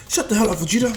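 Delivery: loud, high, irritated shout; angry retort. Acted. Goku yells at laughing Vegeta, angry. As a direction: shout down the heckler.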